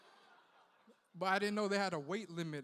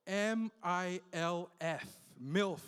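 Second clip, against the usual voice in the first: spelled out slowly; much slower than usual. Shown as a demonstration. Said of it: "spelled out slowly".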